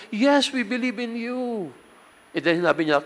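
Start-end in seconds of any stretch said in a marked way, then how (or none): 0.1-1.7 high, bright chorus of the crowd; lightly voiced believing crowd; eager crowd. Crowd answers a third time.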